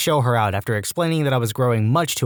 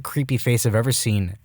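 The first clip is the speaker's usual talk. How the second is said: ordinary delivery, nothing marked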